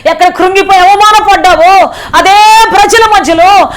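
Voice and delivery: high, loud impassioned pleading to God; fervent revival preacher leading the congregation in loud prayer; full-force preaching prayer. Acted. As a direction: cry out to the Lord with everything.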